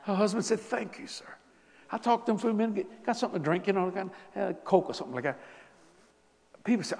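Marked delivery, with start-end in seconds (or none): 0.6-1.3 soft thanks. Retold or imitated. Husband's quiet thanks.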